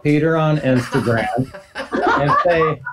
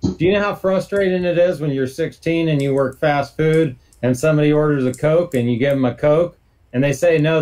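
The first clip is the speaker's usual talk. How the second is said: usual speaking voice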